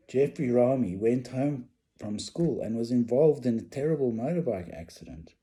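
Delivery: normal talking voice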